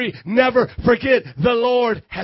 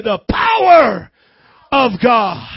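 loud, long-held POWER for the crowd; call-and-response shout; 8 dB louder, slower than usual. Shown as a demonstration. Preacher prompts church to repeat the power of God, loud call. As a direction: lead the congregation in a shouted phrase. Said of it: "Now do it like you mean".

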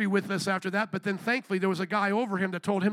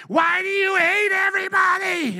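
higher, louder, mocking the angry heckler; mock heckler shout; 8 semitones higher, 6 dB louder than usual. Preacher mimicking a heckler screaming at him, mock shout.

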